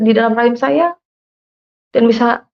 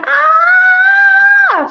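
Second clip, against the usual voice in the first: long, high-pitched scream, briefly acted out; retold scream; 4 dB louder than usual. Telling listeners how she fled the room, screaming. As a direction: let out one sustained high "aah". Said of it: "saya teriak".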